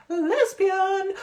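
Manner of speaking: hammed-up high-pitched holler; mock-scandalised outburst. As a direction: stretch the word out high and loud. Mocking the word each time.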